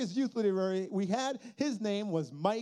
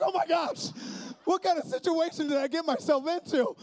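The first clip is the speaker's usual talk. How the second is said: much higher and louder exasperated panic; scared pastor, high and loud; mock alarm; 10 semitones higher, 5 dB louder than usual. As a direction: wail at the mess you're in. Dismay at dangerous situation.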